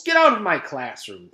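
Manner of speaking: loud firm order; teacher ordering a ghost out, loud; demanding. Acted. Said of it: "she demanded".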